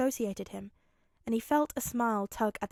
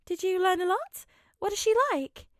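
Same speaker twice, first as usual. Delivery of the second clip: high, bright motherly questions; curious mum; 8 semitones higher, 7 dB louder than usual. Acted. Mum asking her son about his new friend, high and curious. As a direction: ask like an eager mum.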